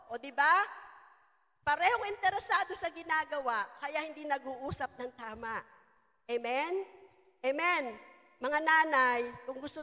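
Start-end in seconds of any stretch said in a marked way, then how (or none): none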